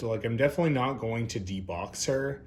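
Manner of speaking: usual speaking voice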